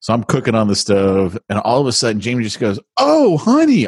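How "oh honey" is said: high, bright and happy; happy sweet call. Retold or imitated. Wife greeting husband on anniversary, happy. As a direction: call out to your husband happily. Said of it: "all, like, happy and stuff".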